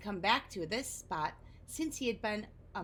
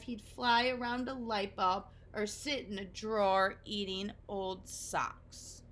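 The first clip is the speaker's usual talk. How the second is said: ordinary delivery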